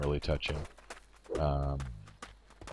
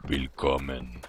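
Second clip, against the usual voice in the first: deep German voice; 2 semitones lower than usual. Pretend. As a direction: play a welcoming guild master with a hint of German. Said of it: "really deep voice".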